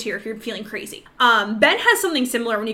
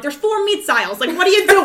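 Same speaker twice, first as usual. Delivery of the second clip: high, exasperated comic New Yorker; New York accent; 7 semitones higher, 5 dB louder than usual. Retold or imitated. Calling lost boyfriend in Costco, exasperated New York accent. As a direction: slip into a loud, mock New York rant. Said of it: "I'm fucking from New York".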